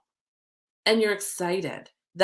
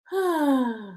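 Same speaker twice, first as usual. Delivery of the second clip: audible settling exhale; real sigh before focusing; long sigh; 5 semitones higher than usual. Real, not acted. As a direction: let out a long, voiced sigh. Settling before pulling cards.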